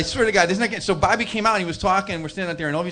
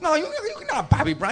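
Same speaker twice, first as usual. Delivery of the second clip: high-pitched mock incredulity; incredulous; 12 semitones higher than usual. Cook refuses to believe it's [name], mock incredulous. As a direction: scoff in disbelief, pitched well up. Cook's disbelief mounts in retelling.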